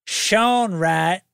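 slow, swooping Kentucky drawl; Kentuckian accent. Pretend. Host reading supporters' names on request, Kentuckian drawl. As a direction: stretch each name in a put-on Kentuckian accent. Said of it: "do it with a Kentuckian accent".

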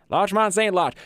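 high-pitched vintage radio host; old-time radio voice. Shown as a demonstration. Player showing off his producer character, old-time radio voice. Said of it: "largely based off of that old-time radio sort of thing".

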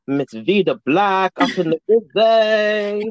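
slow, drawn-out host announcement; hype announcer. Acted. Announcing the guest.

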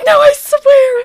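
loud, high, whiny protest; high pleading protest. Retold or imitated. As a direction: plead your innocence, squealing. Guy insisting he's six feet.

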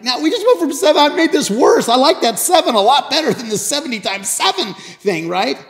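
loud, high-pitched dismayed complaint; stunned protest. Acted. Peter objects to Jesus raising the count, stunned protest. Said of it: "Peter is stunned".

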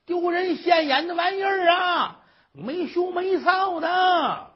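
high, drawn-out wailing tirade; public cursing. Acted. Old mother cursing from the rooftop for all to hear, shrieking tirade. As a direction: hurl long, loud insults for the whole street. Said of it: "妈得上房骂街去".

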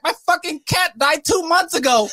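high, mock tearful outburst; emotional wail. Grieving cat owner answering guests, mock emotional wail. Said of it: "Getting really emotional".

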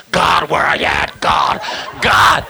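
hammed-up cry out to God; put-on melodramatic prayer voice; mock dramatic praying. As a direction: overdo a desperate plea to heaven. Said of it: "don't have to cause a drama".

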